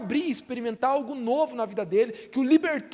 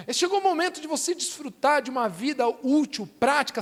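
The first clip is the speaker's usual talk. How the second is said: normal talking voice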